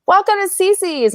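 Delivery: high, slow, sugary greeter voice; saccharine greeting. Retold or imitated. Fired teen mocking the required greeting, sugary and slow. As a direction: sing it out like a too-sweet pizza host. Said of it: "I just can't do that saccharine".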